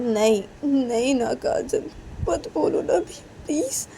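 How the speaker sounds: high, crying whispered plea; tearful begging. Acted. Reshma begging Kajal in tears, whispered. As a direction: beg her through tears not to tell.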